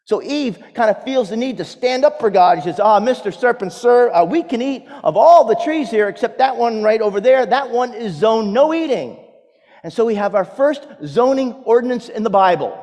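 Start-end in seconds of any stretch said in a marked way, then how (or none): 2.8-9.3 Eve standing firm. Acted. Eve defending God to serpent.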